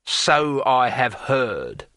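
very slow, drawn-out cold reply; cold menace. Acted. Thief about to attack the wizard, coldly menacing. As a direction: savour it like a smiling villain. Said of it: "Withel smiled coldly".

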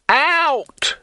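high, loud furious scream. A narrator's reading. Innkeeper screaming at the trolls to leave. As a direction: scream it with full rage. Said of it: "he screamed".

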